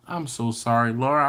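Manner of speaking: ordinary delivery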